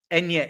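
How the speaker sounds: Enya with a clear ny sound; soft N sound. Shown as a demonstration. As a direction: say Enya, ny as in canyon. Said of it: "It's the soft N".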